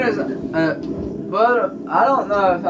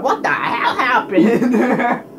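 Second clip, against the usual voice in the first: mock confusion; 5 semitones higher, much slower than usual. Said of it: "He'll be like".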